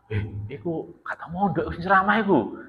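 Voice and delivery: lightly acted sceptical aside among listeners; doubtful gossip. Jamaah gossiping about whether the preacher ever boarded, doubtful murmur.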